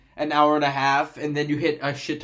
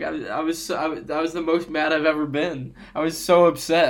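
ordinary talk, nothing marked

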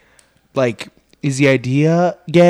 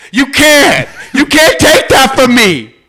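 high, loud comic outcry; hammed-up pleading yell; mock anguished protest; 10 semitones higher, 5 dB louder, slower than usual. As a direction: cry out as if robbed of something dear. Mock protest against NFL ruling.

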